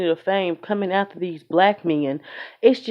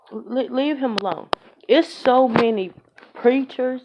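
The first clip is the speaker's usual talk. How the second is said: normal talking voice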